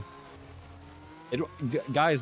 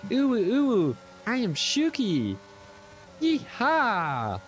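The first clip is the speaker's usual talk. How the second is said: put-on high squeaky anime girl voice; cute anime girl; 11 semitones higher, much slower than usual. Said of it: "Shuki sounds like an anime girl".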